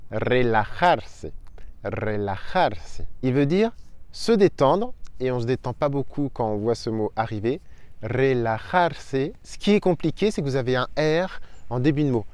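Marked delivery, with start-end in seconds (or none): 0.1-3.0 relajarse slowly, rolled initial r and throaty j; slow model word. Shown as a demonstration. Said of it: "celui-là, il est horrible". 8.0-9.2 relajarse slowly, rolled initial r and throaty j; slow model word. Shown as a demonstration. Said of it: "il est horrible".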